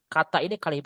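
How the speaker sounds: ordinary talk, nothing marked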